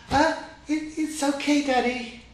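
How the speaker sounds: nervous little elephant hurrying to reassure; quick reply. A narrator's reading. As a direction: blurt it out fast to calm dad. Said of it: "replied Hefler quickly".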